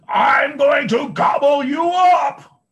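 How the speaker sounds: very high, loud, slow troll roar; thundering troll. A narrator's reading. Troll thunders at the biggest goat, very high, loud and slow. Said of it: "the troll thundered".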